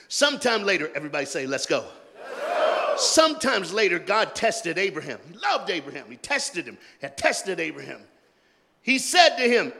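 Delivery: loud, high preacher's reading; whooping sermon reading; full-force preaching. Acted.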